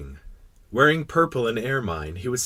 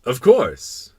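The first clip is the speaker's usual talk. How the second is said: loud royal reply; assured king; 3 semitones higher, 8 dB louder than usual. Acted. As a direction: confirm it as a self-important monarch. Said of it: "the king replied".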